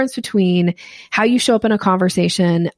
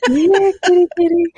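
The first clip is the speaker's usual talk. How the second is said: higher, louder here kitty kitty call; 11 semitones higher, 4 dB louder than usual. Shown as a demonstration. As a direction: sing out the call to a cat. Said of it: "this little thing where you go".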